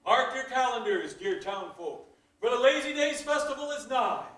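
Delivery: normal talking voice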